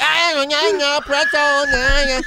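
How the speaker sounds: high, loud mock Vietnamese quarrel; hammed-up Vietnamese wailing; mock Vietnamese accent. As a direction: imitate a bickering couple in caricatured Vietnamese. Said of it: "and they're like".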